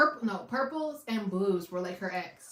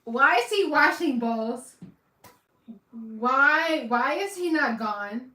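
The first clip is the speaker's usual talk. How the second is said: louder, higher disbelieving cry at the screen; exasperated outburst; 4 semitones higher, 7 dB louder, slower than usual. Real, not acted. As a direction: shout your disbelief at the TV. Reacting loudly to TV scene.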